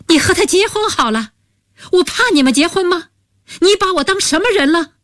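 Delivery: a jealous, wounded retort, high and hurried; agitated outburst. Acted. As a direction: snap back at her rapidly, pitch shooting up. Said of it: "蒋丽丽也急了，大声说".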